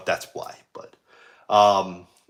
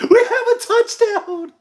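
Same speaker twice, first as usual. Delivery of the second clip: much higher, slow mock touchdown call; replaying own call; 3 dB louder, slower than usual. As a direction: ham up your own excited call. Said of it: "I was so excited".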